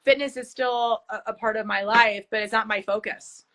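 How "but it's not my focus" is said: normal talking voice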